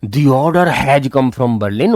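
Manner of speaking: slow, deliberate textbook reading; slow reading aloud. Shown as a demonstration. Teacher reading the story slowly to students. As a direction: read each word out slowly for the class.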